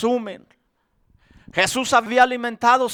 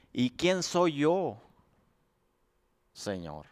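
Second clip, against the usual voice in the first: humble doubt; 8 semitones lower, 7 dB quieter than usual. Acted. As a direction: shrink before the Lord. Moisés duda ante Dios. (duda humilde, baja y suave)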